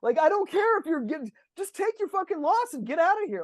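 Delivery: high, indignant rant; hammed-up disbelief at a quitter; mock outrage.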